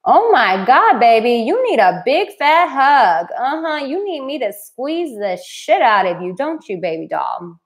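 put-on swooping sing-song pitch, cooing over a big baby; gushing mock coddling. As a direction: smother them with exaggerated doting. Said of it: "you're just like".

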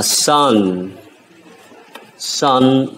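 "sun" with a light hissing s; light S sound. Shown as a demonstration. Teacher models the sound for students, light S. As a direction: start "sun" on a light s, not sh. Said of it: "S nhẹ, sun".

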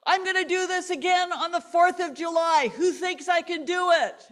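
loud, high call to the crowd; shouted announcement. Retold or imitated. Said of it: "he shouted".

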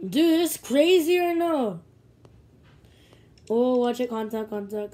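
ordinary talk

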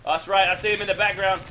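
ordinary talk

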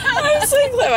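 high, squealed through laughter; laughing boast. Real, not acted. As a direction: crow it while cracking up. Laughing self-praise.

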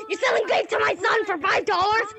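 hammed-up tearing into someone; outraged parent. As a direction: wail it in acted outrage. Furious parent confronts vape seller.

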